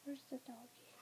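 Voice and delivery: soft whispered question; whispering. Real, not acted. Whisper to baby.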